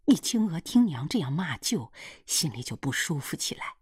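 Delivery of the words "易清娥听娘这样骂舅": plain reading voice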